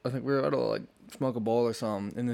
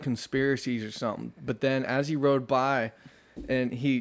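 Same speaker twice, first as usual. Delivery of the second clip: ordinary delivery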